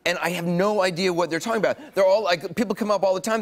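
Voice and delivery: ordinary talk, nothing marked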